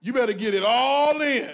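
ordinary delivery

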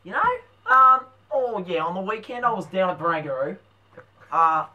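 put-on broad Australian bloke; bogan accent. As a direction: play the outback mate. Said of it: "we're going Bogan".

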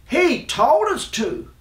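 high, drawn-out blame-shifting; protesting cry. A narrator's reading. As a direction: cry out like knights passing the blame. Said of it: "they cried".

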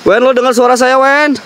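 high, questioning call. Real, not acted. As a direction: call into the distance for a reply. Calling out for missing companion.

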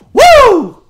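loud, high cheer; excited whoop. Real, not acted. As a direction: whoop in triumph. Great trade lands.

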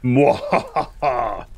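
slow villainous cackle; evil laugh. Pretend. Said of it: "Is how I would react if I wanted them stuck".

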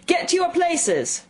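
high, drawn-out royal bellow; slow, imperious shouted command; thunderous shout. A narrator's reading. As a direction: thunder the order across the grounds. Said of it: "shouted the Queen in a voice of thunder".

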